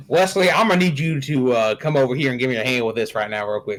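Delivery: folksy Southern accent, badly done. Shown as a demonstration. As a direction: put on a hammy Southern accent. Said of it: "Southern accent if I want to".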